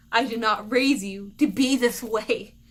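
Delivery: loud imitation of a mother's telling-off; mock-stern parental scolding. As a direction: hit each word hard, like an offended mom. Mom scolds ungrateful child.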